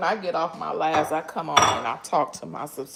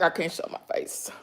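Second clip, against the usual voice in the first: ordinary delivery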